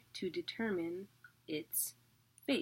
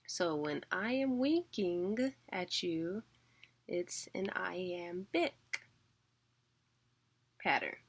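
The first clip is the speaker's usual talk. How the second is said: slow, deliberate; slower than usual. Shown as a demonstration. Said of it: "teacher slows for the rule".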